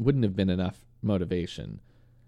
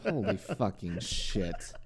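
exasperated; 6 dB quieter, slower than usual. Real, not acted. Exasperated about being teased.

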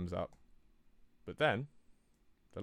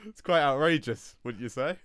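laughing outrage; 5 semitones higher, 7 dB louder than usual. Real, not acted. Mock indignation after punchline.

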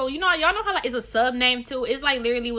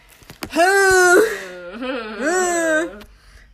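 loud, high, drawn-out groaning sigh; huge dramatic sigh; 7 semitones higher, 10 dB louder than usual. Pretend. Host sighs theatrically before the Snapchat story. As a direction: heave an exaggerated sigh.

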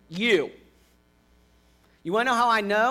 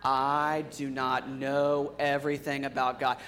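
flat, slow group recital; monotone; 6 semitones lower, 6 dB quieter, slower than usual. Shown as a demonstration. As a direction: recite it flat, like a rote chant. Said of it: "it sounded kinda, like, monotone".

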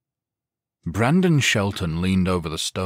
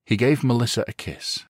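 plain reading voice

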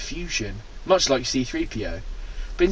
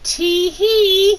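put-on high girlish giggle; giggling; 4 dB louder than usual. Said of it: "he giggled".

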